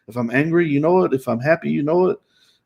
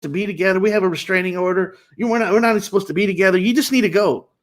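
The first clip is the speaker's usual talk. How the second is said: imitating insistent dismissal, pitched up; mock loud talk through higher pitch, not volume; pressing, hurried retold order to leave; 6 semitones higher, faster than usual. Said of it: "she's still talking loud".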